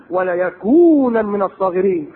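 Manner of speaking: slow drawn-out verse; Quranic recitation. Acted. Preacher recites the wife of al-Aziz's threat, slowly chanted.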